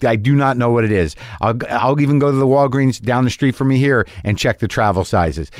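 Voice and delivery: usual speaking voice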